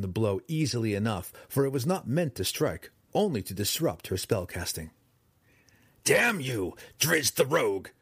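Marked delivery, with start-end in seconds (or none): 6.0-7.9 spat out. A narrator's reading. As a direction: spit the curse at him. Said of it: "she spat".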